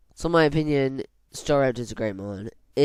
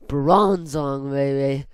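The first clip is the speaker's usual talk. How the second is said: louder, excited shout-out of Bronzong; hyped-up cheer; 2 semitones higher, 6 dB louder, slower than usual. Real, not acted. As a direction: hype up the name Bronzong. Hyped repeat of the pick.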